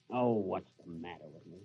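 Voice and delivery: ordinary talk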